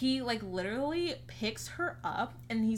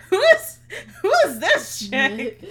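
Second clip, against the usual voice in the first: loud, high words through laughter; laughing; 9 semitones higher, 14 dB louder, slower than usual. Real, not acted. Retelling the scene to a friend, laughing loudly. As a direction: laugh through a mock-outraged question.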